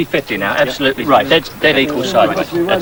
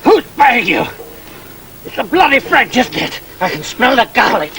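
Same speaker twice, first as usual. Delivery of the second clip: raised, scornful questioning; interrogating officer; 9 semitones higher than usual. Acted. Officer accusing the mercenary of working for the French, scornful. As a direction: sneer the accusation at him.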